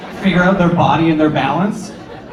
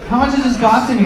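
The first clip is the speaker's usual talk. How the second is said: higher, eager asking voice; mock customer; 7 semitones higher, faster than usual. Customer asking the dancer the price, pitched up. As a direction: ask it like a keen customer.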